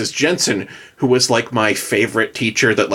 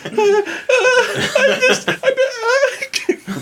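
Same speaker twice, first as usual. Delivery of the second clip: embarrassed stammer; faster than usual. Retold or imitated. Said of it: "I'm just sitting here like".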